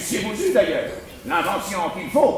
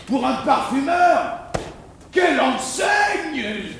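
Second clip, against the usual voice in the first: admiring; 8 semitones higher, 6 dB louder than usual. Acted. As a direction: marvel aloud at it. (ton admiratif et exalté)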